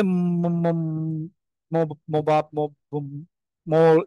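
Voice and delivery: put-on slow halting toddler trying to say a word; baby stammering. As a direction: mimic a baby stuck on the first sound. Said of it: "এই টাইপের কিছু funny but sweet sound".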